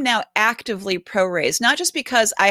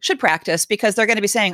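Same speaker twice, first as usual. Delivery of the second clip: usual speaking voice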